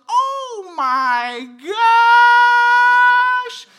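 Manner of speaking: very high, very loud, hugely drawn-out sung exclamation; dramatic sing-song daughter. Retold or imitated. Dad mimics his almost-four-year-old's dramatic tone, sung out high and long. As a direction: stretch it out like a dramatic little diva. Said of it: "in that tone, in that voice".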